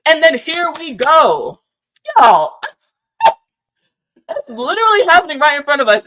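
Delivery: worked-up outburst. Acted. Host working up the livestream audience, loud excited laughing rant. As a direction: crank it up like the punchline just landed. Host ramping up the outrage bit.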